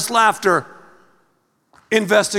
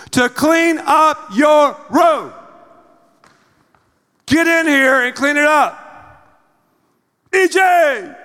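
loud, higher shouted command, dragged out slowly; theatrical yelling; 7 semitones higher, 4 dB louder, slower than usual. Retold or imitated. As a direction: scream up the stairs at the messy son. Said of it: "And I get louder and louder in theatrics".

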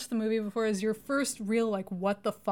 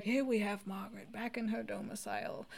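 softer, hushed nature-documentary narration, lightly imitated; David Attenborough; 8 dB quieter than usual. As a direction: murmur it like a wildlife host watching an animal. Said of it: "David Attenborough, like, doing a voice".

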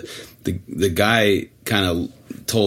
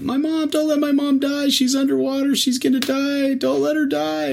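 put-on much higher child's voice begging; panicked kid. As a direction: play the frantic kid pleading. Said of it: "this kid's like".

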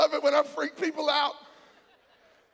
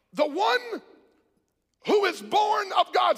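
slow, weighty sermon phrases with big pauses; emphatic preaching; 5 semitones higher, much slower than usual. Real, not acted. Preacher answering the question from the text, emphatic preaching. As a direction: proclaim the scripture phrase by phrase.

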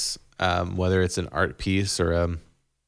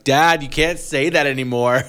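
put-on kids' complaint; 6 semitones higher, 5 dB louder than usual. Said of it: "They're like".

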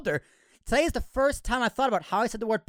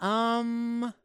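'um' held long and level; stressed first syllable. Retold or imitated. Host copying the old pronunciation, drawn-out 'um'. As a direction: stretch out the 'um' from umbrella. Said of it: "I used to say umbrella".

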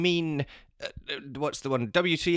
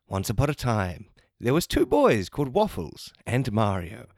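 normal talking voice

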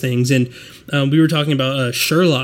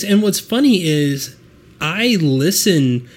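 ordinary talk

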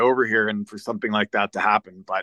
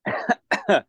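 sharp choking cough; 8 semitones higher than usual. Real, not acted. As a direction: cough as if something went down wrong. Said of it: "excuse me, I'm just choking".

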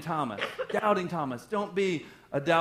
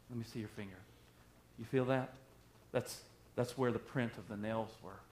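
soft, low and gentle; Jesus gently reassuring Thomas; tender; 8 semitones lower, 8 dB quieter than usual. Acted. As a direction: soothe a frightened friend.

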